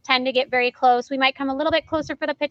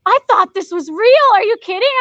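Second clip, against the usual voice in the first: amazed; 7 semitones higher, 6 dB louder than usual. Retold or imitated. Agent amazed frying pan is fake.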